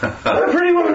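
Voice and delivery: high, squealing delight over a favorite film; swooning mock fan voice; gushing friend. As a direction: gush like a starstruck fan. Friend Angela gushing over movie.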